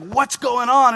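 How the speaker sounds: high, loud alarmed question; urgent question. Retold or imitated. Nurse running up to the scared father, alarmed. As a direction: rush it out in alarm.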